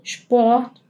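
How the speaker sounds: "Sport" with shp; German sp. Shown as a demonstration. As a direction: say "Sport" as shport, slowly. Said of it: "pronunciadas como sh".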